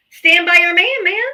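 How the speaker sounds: taunting lyric. Real, not acted.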